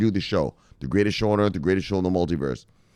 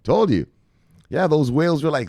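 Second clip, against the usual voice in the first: ordinary talk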